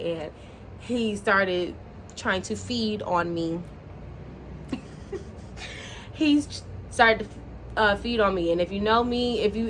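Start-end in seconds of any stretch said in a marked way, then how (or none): 3.6-6.1 soft, airy, nearly silent laughter; quiet helpless laugh. Real, not acted. Embarrassed by her own confession to viewers, quiet helpless laugh. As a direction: laugh it out quietly under your breath.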